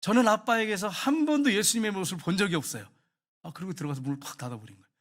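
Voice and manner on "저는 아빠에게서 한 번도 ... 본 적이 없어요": acted, raised-pitch cry of reproach; loud, mock-tearful accusation; tearful outcry. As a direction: imitate a hurt daughter crying out at her father. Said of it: "울면서".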